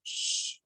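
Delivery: soft breathy shush. Pretend. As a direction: hush them to keep it secret. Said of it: "don't tell anyone".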